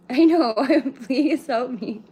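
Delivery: loud, high laughing, slowing at the end. Real, not acted. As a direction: laugh helplessly through the words. Laughing at chat comment.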